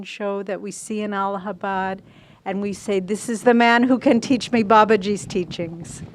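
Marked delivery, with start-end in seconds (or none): 3.0-6.0 loud, delighted declaration; acted exclamation of discovery, much louder; excited discovery. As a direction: proclaim it loudly, like a great find. Acted cry of discovering a teacher.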